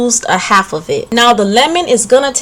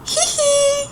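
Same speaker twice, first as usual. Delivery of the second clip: breathy cutesy giggly; 6 dB quieter, slower than usual. Acted. Teasing husband, flirty giggle.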